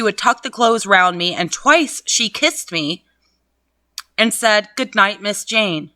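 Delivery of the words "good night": ordinary delivery, nothing marked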